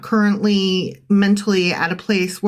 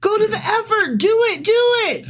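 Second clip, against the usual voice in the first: very high, hammed-up goading; sarcastic; 12 semitones higher, faster than usual. Prophet urging the doomed city on, sarcastic. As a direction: squeal the orders in mock encouragement. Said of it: "It's sarcasm".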